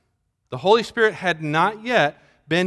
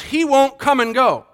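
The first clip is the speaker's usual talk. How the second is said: normal talking voice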